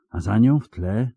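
usual speaking voice

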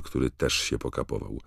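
plain reading voice

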